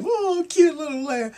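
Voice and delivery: put-on very high, cooing little girl voice; a sweet, high child's gush over a pet. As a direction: coo at the lamb like a small girl.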